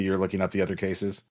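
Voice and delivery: usual speaking voice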